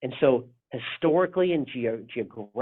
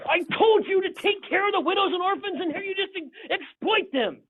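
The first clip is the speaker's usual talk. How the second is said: exasperated prophet ranting. Retold or imitated. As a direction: rant at the people as a fed-up prophet. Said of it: "the prophets are gonna come and they're gonna be like".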